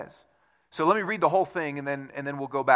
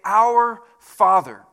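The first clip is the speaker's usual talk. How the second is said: Our Father, slow and louder with stress on Our; slow emphatic quote; 4 semitones higher, 10 dB louder, much slower than usual. Shown as a demonstration. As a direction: proclaim it slowly, leaning on Our. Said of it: "You start and you say".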